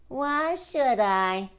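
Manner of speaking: slow, stubborn Turtle; Turtle's voice. Acted. Turtle refusing Frog's request slowly. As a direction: drag the question out.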